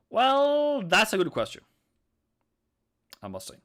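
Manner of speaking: high, loud showman voice; hammed-up game-show host; mock grand announcer. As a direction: play the pompous host answering.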